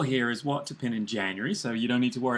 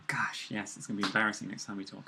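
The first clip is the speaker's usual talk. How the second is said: embarrassed whisper; 5 semitones lower, 8 dB quieter than usual. Real, not acted. Embarrassed aside after bad impression.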